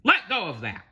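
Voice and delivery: high-pitched angry order; pirate shout. Acted. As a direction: yell at a thief to let go. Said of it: "Burger Beard shouted".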